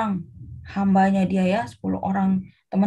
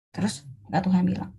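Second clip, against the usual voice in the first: ordinary delivery, nothing marked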